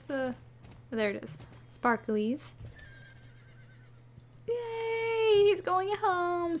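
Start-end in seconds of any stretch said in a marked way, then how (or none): 4.5-6.6 high, drawn-out happy squeal; delighted cheer. Real, not acted. Player cheering a cow heading home, delighted squeal. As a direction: cheer in a tiny high voice, stretching it out.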